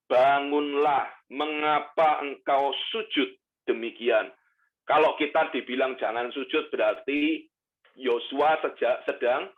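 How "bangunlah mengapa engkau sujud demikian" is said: slow, commanding divine voice; voice of God. Acted. God telling Joshua to get up, slow and commanding. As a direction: command him slowly to rise. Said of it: "berfirmanlah Tuhan kepada Yosua".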